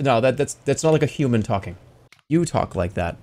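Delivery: ordinary talk, nothing marked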